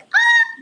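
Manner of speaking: sudden very high, loud squeak. Retold or imitated. Woman imitating the sound she let out, squeak. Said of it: "like, squeak".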